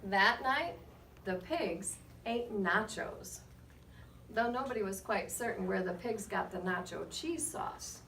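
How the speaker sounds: put-on lively read-aloud to children, rising on names; story narration. A narrator's reading. Reading to the class with lively storybook narration. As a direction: tell it like a picture book to a class. Reads picture book aloud.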